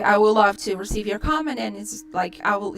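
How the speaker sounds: usual speaking voice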